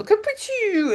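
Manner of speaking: high, hammed-up reveal; mock Basil. Basil in disguise announcing himself, hammed-up. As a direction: toss it off grandly.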